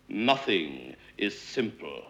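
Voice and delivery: low, soft, measured dignity; dignified. A narrator's reading. Mister Franklin after falling in twice, quietly dignified. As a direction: say it with wounded dignity. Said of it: "he said with dignity".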